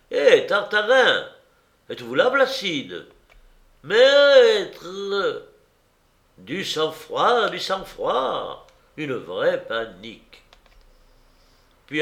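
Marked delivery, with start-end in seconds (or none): 0.1-5.4 loud, high searching calls; calling out. A narrator's reading. Delegates seek each other in thick mist, calling out. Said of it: "et s'appelaient". 6.4-8.7 slow, drawn-out rise and fall; urging calm. A narrator's reading. Someone answers the panicking party, slowly urging calm.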